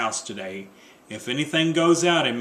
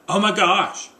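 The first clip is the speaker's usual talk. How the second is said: higher, louder burst of alarm; sudden exclaim; 6 semitones higher, 4 dB louder than usual. Acted. Wife spotting a rule on the list, alarmed cry. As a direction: blurt it out in alarm.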